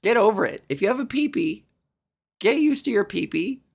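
ordinary talk

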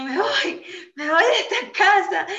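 high, loud mock-tantrum cry; child's tantrum. Small girl telling her mami she is leaving, hammed-up tantrum. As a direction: play a little kid threatening to leave. Retells herself threatening mom as child.

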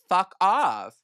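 a sudden loud, high yell of "fuck off"; shouted fuck off. Retold or imitated. To a cheating narcissist, a loud high shouted "fuck off". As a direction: blast "fuck off" at full voice. Peak of imagined rant.

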